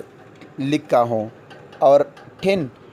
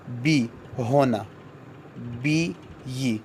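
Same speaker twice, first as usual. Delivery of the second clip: spelled out slowly; 7 dB quieter, much slower than usual. Shown as a demonstration. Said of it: "یہ کی آواز دیتے ہیں".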